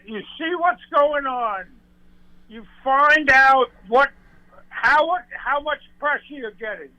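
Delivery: ordinary delivery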